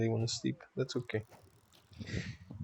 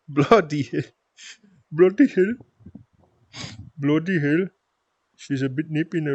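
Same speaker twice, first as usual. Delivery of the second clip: put-on higher-pitched Scottish accent, playfully tried; attempted Scottish accent; 4 semitones higher, 4 dB louder than usual. Streamer retrying after chat's teasing, Scottish accent. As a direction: try it in Scottish. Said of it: "better with the Scottish accent nowadays".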